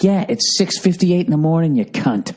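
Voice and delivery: high-pitched mock exasperation, dropping on the insult; irritated retort. Comic retorting to early-morning radio caller, irritated.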